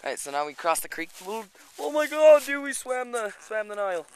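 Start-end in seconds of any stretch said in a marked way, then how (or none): 1.3-4.1 a loud, high, drawn-out "oh my God"; excited exclamation. Real, not acted. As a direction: burst out with real excitement as you cross the creek. While crossing the creek.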